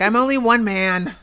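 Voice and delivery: loud, high, drawn-out mock complaint; mock protest. Maintenance man protesting his workload, loud mock exasperation. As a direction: wail it as an overworked man.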